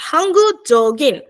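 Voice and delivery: teacher repeating Hangu Dogin clearly; slow pronunciation demo. Shown as a demonstration. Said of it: "É".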